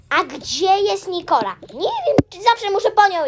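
nearly shouting across the room; loud calling. Pretend. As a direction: call out loudly in pretend exasperation. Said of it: "że tak głośno mówię".

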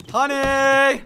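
very high, loud silly papa cry; hammed-up bellow. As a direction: belt it out like a greedy cartoon dad. Mock role of hungry papa.

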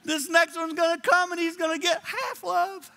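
high, tearful father's fear; retold tearful worry. Father crying in his son's bedroom, high and tearful. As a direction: voice it like a dad crying over his sleeping son. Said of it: "I remember crying".

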